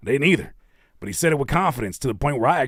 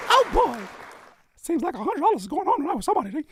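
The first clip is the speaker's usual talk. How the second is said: high falsetto cartoon squeak; Mickey Mouse. Host riffing on Disney's mouse, squeaky voice. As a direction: chirp it in a helium voice. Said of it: "his Mickey Mouse voice".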